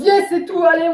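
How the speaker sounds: high-pitched celebratory yes; elated, retold relief; mock triumph. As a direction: act out a little victory cheer. Acts out relief at escaping.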